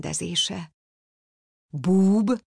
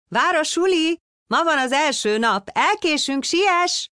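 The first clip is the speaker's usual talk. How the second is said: put-on much higher, louder, eager child's call; excited shout; 12 semitones higher, 4 dB louder than usual. A narrator's reading. Little son loudly wakes his father for school. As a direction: bounce out of bed yelling. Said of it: "kiabálta".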